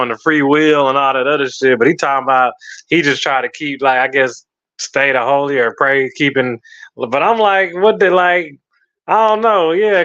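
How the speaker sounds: usual speaking voice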